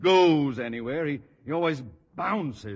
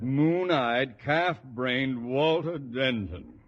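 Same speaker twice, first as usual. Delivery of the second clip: slow contempt; much slower than usual. Acted.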